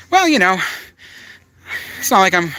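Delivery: high, loud defensive "well, you know"; sarcastic retort. Real, not acted. Streamer answering a comment calling his videos boring, sarcastic and defensive. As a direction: push back at the heckler with mock indignation. Reply to "your vids are boring.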